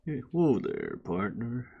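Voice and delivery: low, soft Southern cowboy greeting; low, hushed Southern cowboy voice; cowboy Southern accent. Retold or imitated. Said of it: "sometimes it's just a slur".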